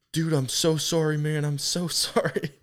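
high-pitched imitation of an apologetic friend; hammed-up, high pleading apology; mock apology. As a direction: plead sorry in a put-on high voice. Acting friend's apology after blowing shot.

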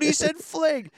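high-pitched teasing squeal; mocking friends. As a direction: crow it up high, making fun. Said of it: "I used to get made fun of in college".

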